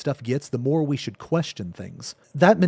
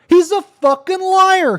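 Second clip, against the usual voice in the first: much higher and louder, slow, leaning on fucking liar; furious; 8 dB louder than usual. Real, not acted. Streamer to viewers about the accuser, furious repeat. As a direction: escalate into full anger. Escalating angry rant.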